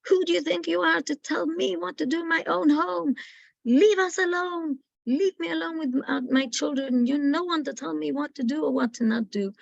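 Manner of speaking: the grandmother ordering the official from her home; defiant outburst. Retold or imitated. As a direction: stand your ground and throw her out.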